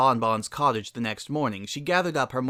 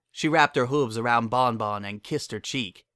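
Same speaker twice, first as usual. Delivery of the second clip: plain reading voice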